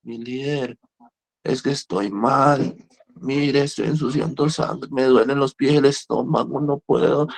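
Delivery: slow, drawn-out mock ailing plea; imitating sick patient. Patient complaining to his leader about pains, hammed-up agony. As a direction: drag it out like a sufferer listing pains. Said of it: "Les voy a decir literalmente cómo habla".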